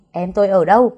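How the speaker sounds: angry demand. Acted. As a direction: shout it at him. Confronts Tuấn, demands sister's whereabouts. (quát lên giận dữ)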